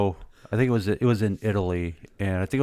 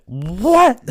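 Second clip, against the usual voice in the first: high, loud, laughing yelp of disbelief; a loud high laughing cry of pure shock; shocked laughing what; 10 semitones higher, 6 dB louder than usual. Retold or imitated. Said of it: "And I went".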